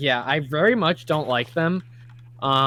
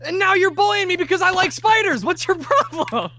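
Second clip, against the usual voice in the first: indignant; 14 semitones higher, 4 dB louder than usual. Real, not acted. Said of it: "first time I've seen her angry".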